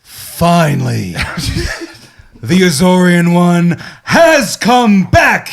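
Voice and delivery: slow, loud, high grand proclamation; booming villain return. Pretend. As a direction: announce your return like a dark lord. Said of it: "To hurt your ears after yelling".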